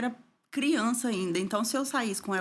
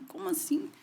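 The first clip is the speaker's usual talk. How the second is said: much higher, puzzled question; mock surprise; 10 semitones higher than usual. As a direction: act genuinely taken aback. Retells her reply to women. (surpresa, bem mais aguda)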